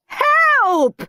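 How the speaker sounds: high loud cry for help; shouted. A narrator's reading. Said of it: "shouted Henry".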